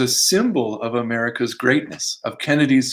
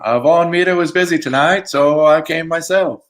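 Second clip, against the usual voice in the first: higher, louder Boston accent imitating a president; Kennedy Boston accent; 5 semitones higher, 5 dB louder than usual. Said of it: "I'll do the accent this time".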